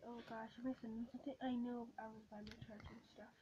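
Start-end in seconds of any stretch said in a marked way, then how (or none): 2.0-3.3 soft unvoiced whisper. Real, not acted. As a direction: whisper it to yourself. Hushed aside.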